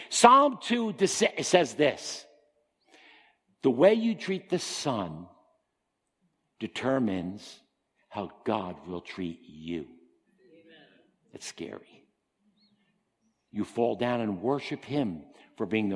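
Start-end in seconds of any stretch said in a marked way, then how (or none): 3.6-9.8 slow solemn warning. Acted. Preacher warning the congregation, slow solemn. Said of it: "same words said twice". 9.8-12.1 whispered amen; whispered aside. Real, not acted. Preacher reacting to his own warning, whisper.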